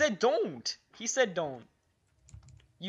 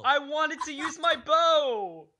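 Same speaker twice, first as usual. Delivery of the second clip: frustrated wail; 5 semitones higher, slower than usual. Real, not acted. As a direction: wail about your own mistake. Complaining after losing.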